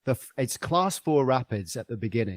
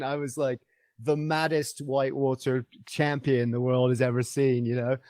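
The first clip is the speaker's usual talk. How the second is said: ordinary delivery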